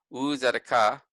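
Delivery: u tzedakah, stressing the final "kah"; stress on last syllable. Shown as a demonstration. Teacher reading the Hebrew word to students, stress on last syllable. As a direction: put the weight on the end of tzedakah. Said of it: "put emphasis on the last syllable".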